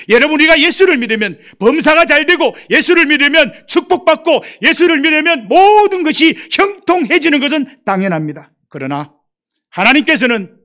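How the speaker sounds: loud, high, driving sermon climax; fervent revival preaching; preaching at full force. Acted. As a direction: proclaim it over the congregation.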